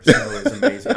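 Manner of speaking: put-on high, loud childlike delight; eighteen-year-old from Texas seeing real snow, delighted; thrilled. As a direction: gush about the snow. Young recruit thrilled by snow.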